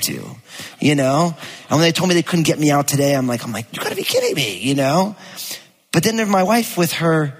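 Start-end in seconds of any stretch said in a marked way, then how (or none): 3.7-4.6 exasperated disbelief. Retold or imitated. Stranded father reacting to the gate agent, exasperated and high. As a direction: squeak it in disbelief. Told flight is gone.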